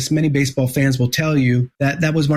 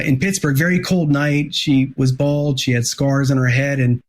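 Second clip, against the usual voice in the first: usual speaking voice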